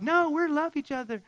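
high, mock-dreamy young lover; high mock-innocent promise; naive young couple. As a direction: play the starry-eyed new dater.

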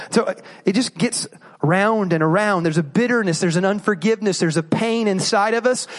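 ordinary talk, nothing marked

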